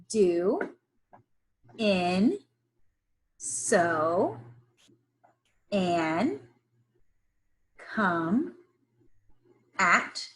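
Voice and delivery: each word spaced and drawn out; slow word list read. Shown as a demonstration. Teacher modelling the word list slowly for kids. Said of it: "I'm gonna read it one more time".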